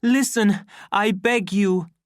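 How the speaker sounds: high, loud appeal; pleading cry. A narrator's reading. Savage begging the deltas, crying out. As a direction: beg a crowd to hear you. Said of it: "cried the savage".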